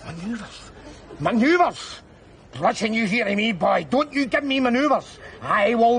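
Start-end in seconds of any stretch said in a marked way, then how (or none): 0.0-0.7 quiet disbelief. Acted. Repeats the soldier's word. 1.2-2.0 indignant echo. Acted. Repeats the word indignantly.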